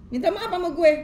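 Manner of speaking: high, loud demand for an apology; acting a scorned lover demanding sorry; angry demand. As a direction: snap it out as a demand, pitched high.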